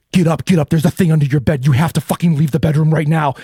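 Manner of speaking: loud, urgent shouted command; urgent warning. Acted. TV voice shouting at the man to leave now, loud. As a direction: shout him awake.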